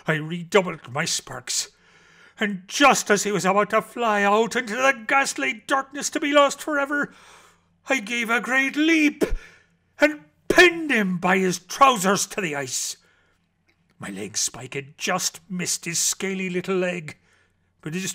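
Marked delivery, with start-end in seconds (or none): 2.4-13.0 climactic excitement. A narrator's reading. Climactic rescue leap.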